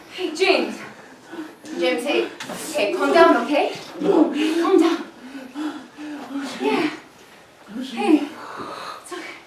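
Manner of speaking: low, soft reassurance; quietly calm the injured man; soothing. Acted. She soothes the wounded man.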